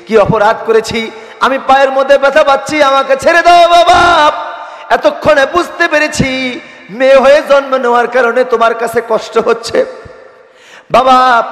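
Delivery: put-on high, loud preacher's cry for a begging child; pleading daughter. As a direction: beg father to let go, voice soaring. Bound daughter begs her father. (ওয়াজের সুরে মেয়ের উঁচু গলায় আকুতি)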